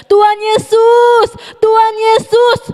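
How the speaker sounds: high, slow, loud calling; yelling for Jesus. Retold or imitated. Blind beggar yells for Jesus over the crowd, high slow shout. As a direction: shout out to the Lord from far away. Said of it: "dia teriak apa?".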